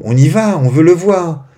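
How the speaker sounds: high, loud, impatient plea; eager child. Acted. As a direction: insist like a kid raring to go. Enfant impatient dans l'histoire. (enfant impatient, voix haute et forte)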